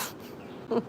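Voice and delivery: breathy giggle at her own claim; laughs. Real, not acted. Laughing at the idea she was an angel. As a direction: laugh it off, caught out. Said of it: "I can't even say it without laughing".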